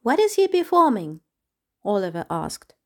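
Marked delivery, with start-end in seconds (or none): 0.0-1.2 very high, eager question; curious lizard. Acted. Lizard Oliver asks, high.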